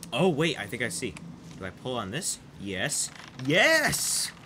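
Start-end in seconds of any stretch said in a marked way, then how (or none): 3.5-4.3 high, loud, drawn-out cheer; triumphant yes. Real, not acted. Player solving the puzzle, triumphant cheer.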